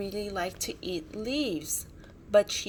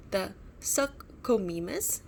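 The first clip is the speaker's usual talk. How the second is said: plain reading voice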